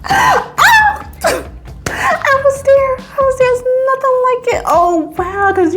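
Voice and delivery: very high swooning shriek; squeal, swept away; giddy swoon; ecstatic squeal. Real, not acted. Swooning over the carry.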